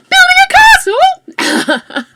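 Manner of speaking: very high, slow, loud squeal; surprised squeal. Real, not acted. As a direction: shriek it in delighted disbelief. Squeals at castle idea.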